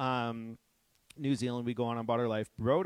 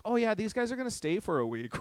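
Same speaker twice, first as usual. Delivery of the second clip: breezy friend; 11 semitones higher, 4 dB louder than usual. Retold or imitated. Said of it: "and she's like".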